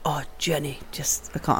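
low, soft imitation of a weary friend; reluctant retelling. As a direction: drop the voice into a quiet, mock-reluctant plea.

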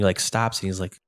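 ordinary delivery

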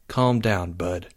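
hushed, low calming words; soft, low aside; whispered. A narrator's reading. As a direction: quietly settle your friend down. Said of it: "Jake whispered".

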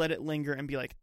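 ordinary talk, nothing marked

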